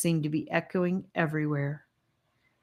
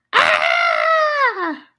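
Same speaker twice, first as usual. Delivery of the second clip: sudden high loud scream; 9 dB louder than usual. A narrator's reading. Charlie screams when kicked, loud and high. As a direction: cry out in pain. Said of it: "he screamed".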